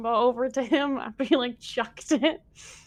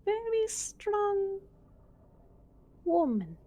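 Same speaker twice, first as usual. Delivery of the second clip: slow, high, quiet lizard mutter; 9 semitones higher, 7 dB quieter than usual. Acted. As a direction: mutter it slowly under your breath like a lizard. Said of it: "mutter quietly to himself".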